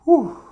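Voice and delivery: loud sharp exhale after cold water; sharp loud whew. Real, not acted. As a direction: blow the air out hard, high and loud.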